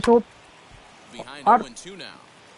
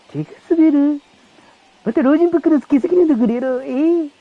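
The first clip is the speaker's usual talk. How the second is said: incredulous manager; 7 semitones higher than usual. Acted. Manager mocks player's slipped-hand excuse, high and fast. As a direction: throw the excuse back at him in disbelief.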